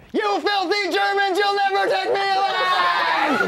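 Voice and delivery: mock heroic last stand; defiant war-movie holdout. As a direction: play a soldier refusing to surrender. Voice above shouting defiance.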